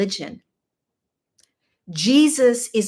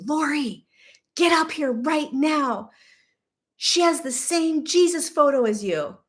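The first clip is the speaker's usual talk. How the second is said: high, urgent call from up the stairs; calling upstairs; 8 semitones higher than usual. Retold or imitated. Girlfriend calling Lori upstairs to see the photo, urgent high call.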